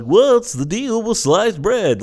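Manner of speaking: high, drawn-out stand-up delivery; mock a comedian's corny opener, slow and high; hack comic. Mimicking a hack stand-up comic's bit.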